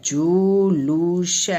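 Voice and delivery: जुलूस very slow, each syllable held; जुलूस drawn out in three beats; sounded out slowly. Shown as a demonstration. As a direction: stretch जुलूस into जु, लू, स. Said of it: "क्या होता है".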